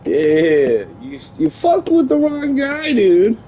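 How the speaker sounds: loud triumphant taunt; taunting shout. Real, not acted. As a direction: crow over the beaten rival. Taunting game opponent.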